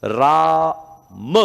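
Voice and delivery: राम said slowly with a full final म; full Ram. Shown as a demonstration. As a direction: draw out राम, sounding the म fully. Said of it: "तो राम कैसे बोलूंगा".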